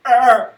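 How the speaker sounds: high loud shriek of panic; panicked shriek. Retold or imitated. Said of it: "she's just like".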